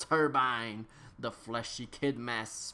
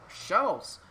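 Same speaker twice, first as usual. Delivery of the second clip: exclaimed; 7 semitones higher, 3 dB louder than usual. Acted. Exclaimed narration word.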